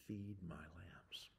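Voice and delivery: slow, whispered words of Jesus; soft whisper. Acted. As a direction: breathe it out gently, slowly. Jesus quoted softly in sermon.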